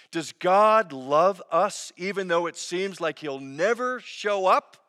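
ordinary delivery

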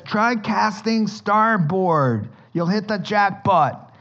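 slow, drawn-out friendly call; Jesus lightly voiced, calling from shore. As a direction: call it across the water slowly.

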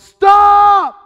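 very loud, high-pitched urgent yell; full-force warning scream. Retold or imitated. Father yelling to his daughter in the car's path, full yell. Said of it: "and I yelled".

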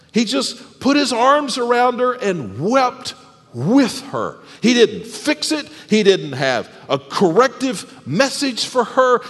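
ordinary talk, nothing marked

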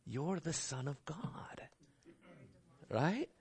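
hushed, slow, whispered temptation; tempting whisper. Retold or imitated. Satan tempting Jesus in the desert, a slow sly whisper. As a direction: whisper it like a seductive lure.

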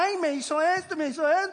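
hammed-up grumbling; mock whining. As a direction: imitate a self-pitying moaner. Mocking believers' complaining.

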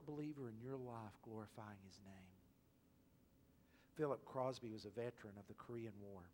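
ordinary talk, nothing marked

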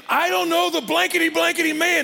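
high, loud, exasperated outburst; angry cursing denial. Acted. Peter's third denial of Jesus by the fire, furious. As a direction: blurt it out hotly, swearing you don't. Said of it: "he calls down curses on himself".

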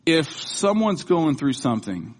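normal talking voice

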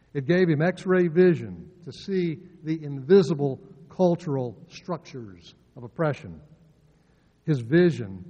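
ordinary talk

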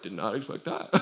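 low, hushed deadpan aside; understated surprise. Retold or imitated. Reacting to the dude breaking down crying, understated aside. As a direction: drop right down and say it softly. His aside after the man cries.

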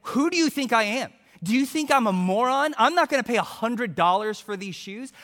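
loud, high indignant outburst; mock yelling, voice jumping up in outrage; retold screaming. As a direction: act out an outraged haggler yelling. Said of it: "I started screaming".

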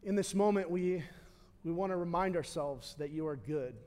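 closing prayer. Acted. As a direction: pray gently over the room.

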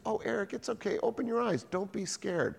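high, soft, placating voice; mock-soothe a frightened little brother; brotherly reassurance. Brother soothes scared child in story.

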